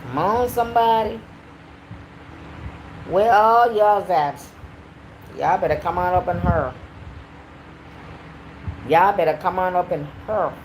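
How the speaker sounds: hammed-up Southern accent, stretching the vowels. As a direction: try out a folksy Southern twang. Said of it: "I'm trying to do my southern accent".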